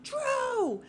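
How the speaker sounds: high, loud drawn-out scream at the screen. Retold or imitated. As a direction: re-enact screaming a name, ending in laughter. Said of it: "I was screaming".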